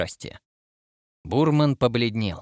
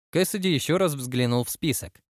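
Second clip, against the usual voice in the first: usual speaking voice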